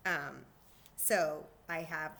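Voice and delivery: ordinary talk, nothing marked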